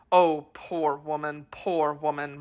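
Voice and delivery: soft faltering murmur. Acted. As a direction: murmur brokenly with grief. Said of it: "in a faltering voice".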